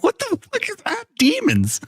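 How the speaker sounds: very high, incredulous squeal; losing it. Retold or imitated. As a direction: squeak it out as if cracking up, retold. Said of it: "I was fucking losing my mind".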